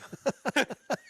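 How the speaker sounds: high-pitched burst of laughter; real, amused laugh at the challenge. Real, not acted. Laughs at a wrestling challenge question.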